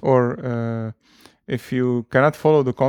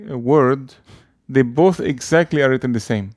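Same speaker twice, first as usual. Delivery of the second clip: ordinary delivery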